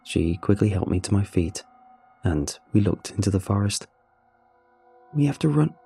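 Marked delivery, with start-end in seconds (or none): 5.1-5.7 higher, urgent whisper-like plea; urgent, breathless. Acted. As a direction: warn her we must run now. Mary Beth urgent.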